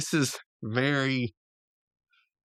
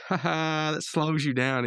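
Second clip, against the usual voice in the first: higher-pitched mocking laugh and jeer; retold taunt; 7 semitones higher, slower than usual. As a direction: gloat playfully at a rival. Retold trash talk during game.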